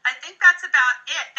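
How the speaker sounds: ordinary talk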